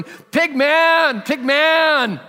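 loud, high yell across the airport crowd; yelling a name. Retold or imitated. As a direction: call the name out at full voice, like paging someone. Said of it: "starts yelling my name".

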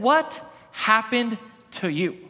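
slow, drawn-out, stressing what; slow shocked question. Retold or imitated. Wife staring at her sunburned husband, slow stunned question. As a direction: let the shock stretch each word.

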